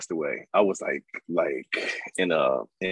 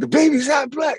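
high, louder punchline voice; voicing homeboy Gary; 5 dB louder than usual. Punchline retold to the host, high friend's voice. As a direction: land the joke in his voice. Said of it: "he was like".

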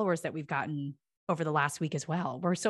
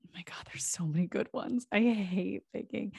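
whisper; 2 semitones higher, 3 dB quieter than usual. Real, not acted. Whispered aside while picking favorites.

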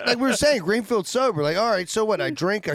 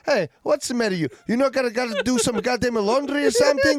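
higher-pitched mock Italian broken English; broken-English Italian accent; 6 semitones higher than usual. Retelling an old Italian worker snapping at a complaining lady, mock Italian accent. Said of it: "he said it in broken English".

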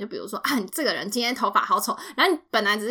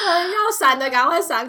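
laughing warning; 8 semitones higher, 4 dB louder than usual. Pretend. Mock fart warning to everyone.

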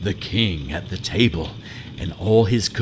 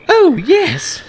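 put-on very high, loud girlish princess; young princess voice; 9 dB louder than usual. Princess surprised to find the frog speaking, very high girlish voice. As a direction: squeak it out like a startled little princess.

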